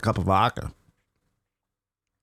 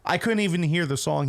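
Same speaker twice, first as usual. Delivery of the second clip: usual speaking voice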